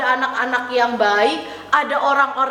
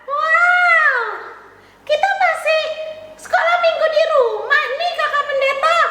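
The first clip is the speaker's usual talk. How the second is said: high, cheery puppet voice; chirpy child puppet saying hello; puppet girl Tiur; 12 semitones higher than usual. Acted. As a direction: greet the kids as the little puppet.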